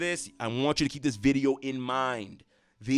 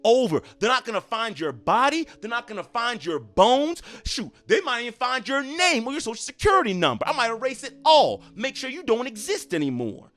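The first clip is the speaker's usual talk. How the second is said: mother's fury; 6 semitones higher, 4 dB louder than usual. Pretend. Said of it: "instead of the mother saying".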